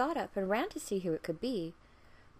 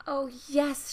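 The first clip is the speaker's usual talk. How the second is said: louder, higher eager agreement; eager princess; 7 semitones higher, 10 dB louder than usual. Acted. Princess eagerly agreeing to the frog. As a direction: jump at the offer.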